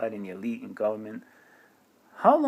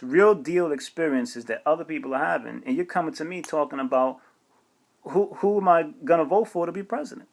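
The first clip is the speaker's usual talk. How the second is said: ordinary talk, nothing marked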